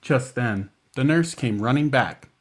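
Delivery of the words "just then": usual speaking voice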